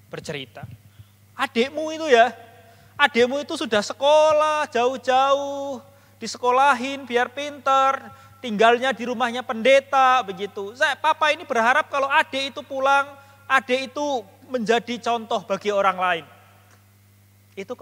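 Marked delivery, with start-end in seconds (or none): 1.3-10.2 higher-pitched, insistent father; father's earnest complaint. Retold or imitated. Father explains his disappointment to his elder child, insistent. Father's account voiced to the preacher. 10.8-16.3 father's earnest complaint. Retold or imitated. Father's hopes voiced in story.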